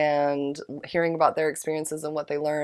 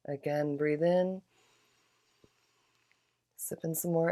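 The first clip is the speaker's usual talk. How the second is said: guided breathing; 5 dB quieter than usual. Acted. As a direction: lead the breaths gently and unhurried. Guides breaths with audible exhales.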